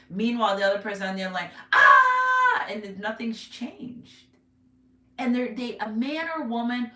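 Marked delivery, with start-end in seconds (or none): 1.7-2.7 high, loud mock scream. Reader imitates the other person on the line, mock screaming. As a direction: scream like the hysterical one on the line.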